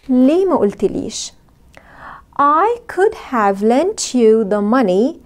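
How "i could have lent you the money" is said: very slow, could have linked; slow model sentence. Shown as a demonstration. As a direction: drill it slowly. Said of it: "Could have نقولها بسرعة". (جملة بطيئة مع ربط could have)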